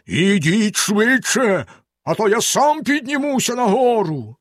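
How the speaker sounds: slow, high bellow in a strange voice; terrible voice. A narrator's reading. Bluebeard bellows upstairs at his wife, terrible voice. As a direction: roar it up the stairs. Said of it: "заволав страшним голосом".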